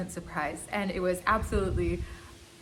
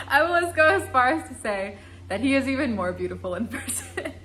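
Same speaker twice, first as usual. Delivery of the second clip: laughing; 6 semitones higher, 7 dB louder than usual. Real, not acted. Giggling while gushing about [name].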